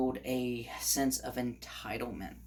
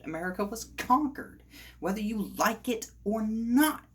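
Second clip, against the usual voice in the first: higher, insistent, driving the point home; worked up; 7 semitones higher than usual. Real, not acted. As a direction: let the irritation push the pitch up. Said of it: "it gets to me".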